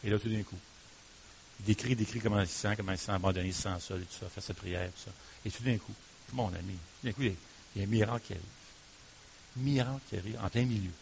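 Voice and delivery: hushed preaching. Real, not acted. Hushed build to miracle.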